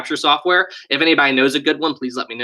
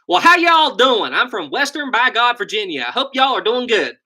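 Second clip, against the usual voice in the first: exaggerated Southern accent; 9 semitones higher than usual. Shown as a demonstration. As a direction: lay the West Virginia accent on extra thick. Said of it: "How's that accent for you?".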